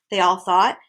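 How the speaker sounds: ordinary delivery, nothing marked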